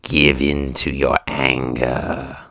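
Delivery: slow, low Sith rasp; Darth Sidious voice. Retold or imitated. Still in the Star Wars villain voice, slow rasp. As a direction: menace them into anger, slowly. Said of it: "kinda locked in there".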